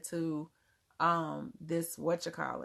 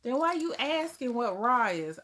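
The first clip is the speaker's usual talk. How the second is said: higher, louder "why", puzzled; confused disbelief; 7 semitones higher, 5 dB louder than usual. Real, not acted. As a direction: ask it in disbelief at the silly question. Confused by a viewer's question.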